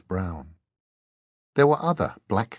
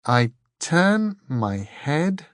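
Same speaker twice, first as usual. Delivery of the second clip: very slow, higher, word-by-word musing; puzzling to herself; 6 semitones higher, much slower than usual. A narrator's reading. Dor works on the monk's riddle, puzzling to herself. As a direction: think it through aloud, drawing out each word. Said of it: "puzzling to herself".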